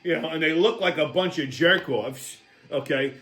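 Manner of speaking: usual speaking voice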